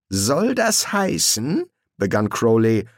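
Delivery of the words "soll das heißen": indignant question. A narrator's reading. Crowley takes offence, interrupted.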